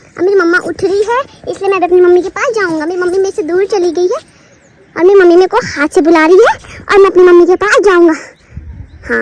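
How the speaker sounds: put-on cute babyish voice, speaking as the toddler. Mother narrating as her baby to viewers, in a cute baby voice. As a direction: voice the baby's thoughts, playful and sweet.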